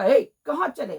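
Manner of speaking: loud sharp halt; guard's challenge. A narrator's reading. Gatekeeper halting the cart: loud challenge. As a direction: stop them at the gate loudly.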